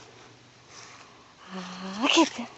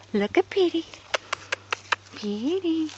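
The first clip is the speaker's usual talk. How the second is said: ordinary delivery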